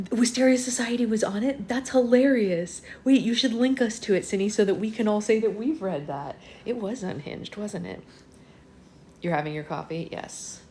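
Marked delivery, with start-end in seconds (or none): 0.1-6.4 loud, high, excited outburst; amazed and delighted, voice lifted; delighted surprise. Real, not acted. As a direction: burst out, loud and high, thrilled by the news. Delighted reaction to viewer's book news.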